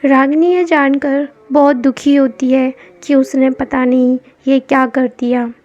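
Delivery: plain reading voice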